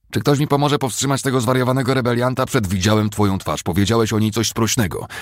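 high, loud, agitated demand; shouting over din. A narrator's reading. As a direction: make yourself heard over the uproar. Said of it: "Cooper musiał przekrzykiwać harmider".

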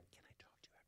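barely voiced hushed whisper. Fan at a twelve step meeting whispering to the actor. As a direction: whisper it like someone interrupting a meeting.

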